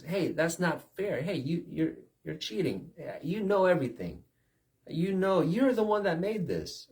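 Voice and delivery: high-pitched accusing complaint; indignant protest. Acted. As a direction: cry foul at a cheating opponent. Protesting player in game anecdote.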